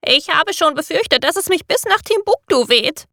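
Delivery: hohe, fremde Papageienstimme. A narrator's reading. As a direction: sprich wie ein aufgeregter Vogel. Papagei spricht nach dem Sturm. (hohe Vogelstimme)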